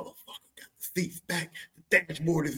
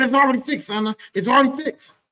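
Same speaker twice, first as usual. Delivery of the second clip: higher, louder dad insisting it's done; 11 semitones higher, 8 dB louder than usual. Retold or imitated. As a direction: play the proud stubborn dad.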